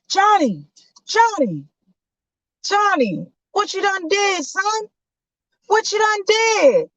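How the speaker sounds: high, pleading father's call; dad shouting for his son in the yard; worried dad calling. Acted. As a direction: call your boy across the yard.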